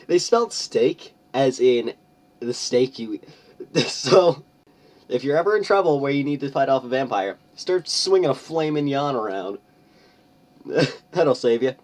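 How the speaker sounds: high, loud, mock-serious advice; sarcastic. Real, not acted. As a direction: deliver like a fake PSA.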